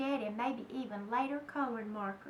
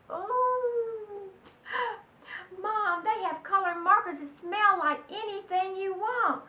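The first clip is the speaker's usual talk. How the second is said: put-on excited child, louder and higher; 6 semitones higher, 7 dB louder than usual. Child pleading with mom, loud and thrilled. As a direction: gush about the markers, bouncing with excitement.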